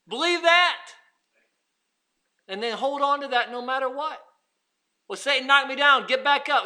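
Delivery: loud, high, driving sermon voice; full-force preaching. Acted. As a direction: preach it out to the whole church.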